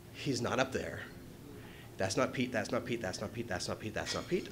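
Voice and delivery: scanning the crowd, voice sinking to a murmur; fading mutter. Retold or imitated. Searching the slope for Pete, fading to a mutter.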